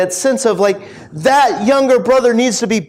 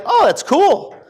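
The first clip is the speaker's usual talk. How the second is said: much higher-pitched, airy-light imitation of approval; mock approval; 9 semitones higher, slower than usual. Older son imagined accepting the news, mock pleased. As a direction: act out a too-easy happy acceptance.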